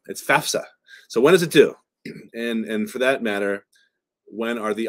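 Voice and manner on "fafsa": "fafsa" pitched up, f before s; correct pronunciation. Shown as a demonstration. Host correcting how some people say it, proper pronunciation. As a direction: say "fafsa" firmly as FAF-suh. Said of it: "Some people pronounce it those ways".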